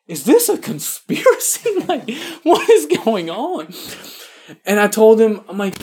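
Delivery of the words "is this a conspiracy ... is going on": high, laughing mock alarm; is this a setup, laughing high; laughing disbelief. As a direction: squeak it out through a laugh, stunned. Retold disbelief at priest question.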